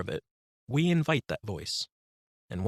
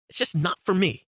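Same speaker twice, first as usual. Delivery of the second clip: higher and louder, fed up; exasperated inner critic; 7 semitones higher, 5 dB louder than usual. Acted. Inner critic giving up on the book, loud and fed up. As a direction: spit it out in frustration. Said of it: "That voice that you just heard was an inner critic".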